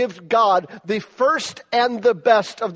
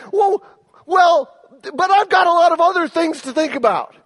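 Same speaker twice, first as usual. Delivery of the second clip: put-on higher, louder, blurted teenage protest; indignant shout; 10 semitones higher, 5 dB louder than usual. Son shouting back at his dad over parking tickets, indignant. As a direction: flare up at your father, defensive. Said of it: "I was mad".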